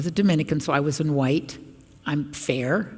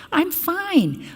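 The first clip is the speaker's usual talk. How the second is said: mock innocent; 13 semitones higher than usual.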